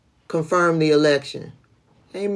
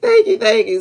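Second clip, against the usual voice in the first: laughing thanks; 14 semitones higher than usual. Real, not acted.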